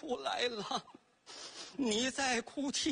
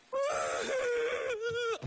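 eerie ghostly wail; 12 semitones higher than usual. Acted. Ghost in the pot wails at the old man, eerie high wail. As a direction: let out a spooky drawn-out cry.

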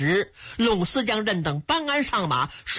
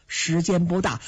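usual speaking voice

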